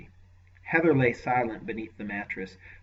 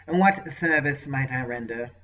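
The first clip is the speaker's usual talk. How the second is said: lightly voiced oily sorcerer, raised pitch; a hint of a fawning servant; nasal unctuous voice; 4 semitones higher, 8 dB louder than usual. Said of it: "a deeply nasal, unctuous voice".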